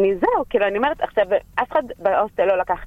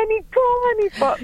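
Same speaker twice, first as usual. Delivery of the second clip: pitched way up, calling out from a distance; calling back; 14 semitones higher than usual. Retold or imitated. Friend in the subway answering her shouts, high calling voice. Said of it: "והיא אומרת לי".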